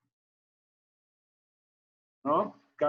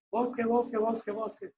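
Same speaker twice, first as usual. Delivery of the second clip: fading echo; 8 semitones higher than usual. Acted. Teacher voicing the cave echo, high and fading. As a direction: let each bosque fade like an echo. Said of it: "¿Y qué oyó Eco?".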